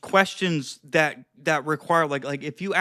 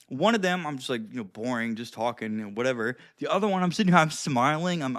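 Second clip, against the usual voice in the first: ordinary talk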